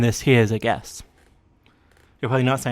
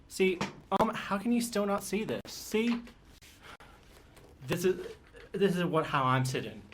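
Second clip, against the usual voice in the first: softer and higher, almost under the breath; soft, high hushed aside; 6 semitones higher, 11 dB quieter than usual. Real, not acted. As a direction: pipe up quietly while showing off. Showing how she sits, hushed.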